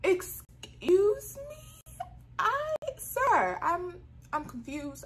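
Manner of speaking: very high, drawn-out bewilderment; stammering, high-pitched mock shock; acted shocked disbelief. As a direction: squeal it slowly, thrown by the accusation. Acting out shock at accusation.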